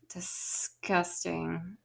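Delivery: disgusted. Real, not acted. Drawn-out disgust.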